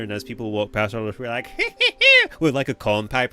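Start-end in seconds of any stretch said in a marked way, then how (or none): 1.5-2.3 high, loud wheezy cackle; prospector cackle. Acted. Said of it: "prospector beard".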